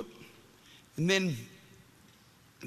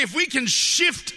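loud, higher, stretched rallying cries; full-force preaching; 5 semitones higher, 4 dB louder than usual. Real, not acted. Preacher urging the congregation to say tonight's my night, full-force preaching. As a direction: rouse the crowd with drawn-out loud phrases.

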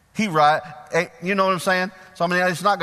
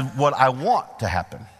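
ordinary talk

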